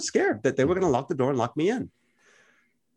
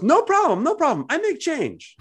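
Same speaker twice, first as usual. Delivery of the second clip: much higher, louder, breezy no problem; imitating cheerful local; 3 dB louder, slower than usual. Palestinian man reassuring the Americans he can give change, much higher and cheerful. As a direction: sing it out like an easygoing shopkeeper. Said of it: "The guy turns around and he goes".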